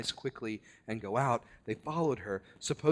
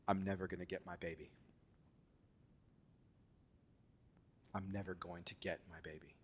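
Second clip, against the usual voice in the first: much softer, fast, defeated murmur; despairing; 5 semitones lower, 13 dB quieter, faster than usual. Retold or imitated. Woman waiting on a baby voiced, soft quick despair. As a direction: let it slip out quickly, crushed.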